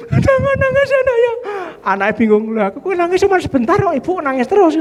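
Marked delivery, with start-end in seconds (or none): 0.1-1.3 put-on high, whiny mother comforting her child; mock crying mother. 2.6-4.8 high, lightly voiced puzzled child.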